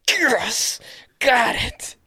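hushed, high-pitched celebration; whispered triumph. Retold or imitated. Viewer celebrating guessing the Australian accent, whispered triumph. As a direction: whisper it like a secret little victory.

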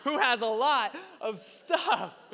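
hammed-up wounded pride, ready to prove him wrong; prideful inner voice. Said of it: "the pride in myself was like".

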